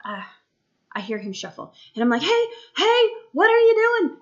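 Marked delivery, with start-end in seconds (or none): 2.2-4.2 high, louder retold holler; calling out. Mom hears son shuffle and calls out, high holler. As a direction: call out insistently, pitched way up. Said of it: "I keep repeatedly asking".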